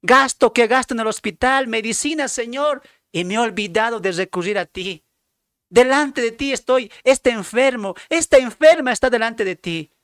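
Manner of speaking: high, loud plea to God; sick person pleading. Acted. Preacher voicing a sick believer begging God, loud high plea. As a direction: cry out from a sick bed for help. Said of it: "Lo mismo los enfermos".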